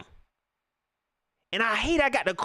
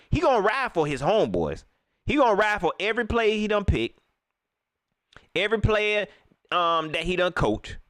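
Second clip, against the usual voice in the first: ordinary delivery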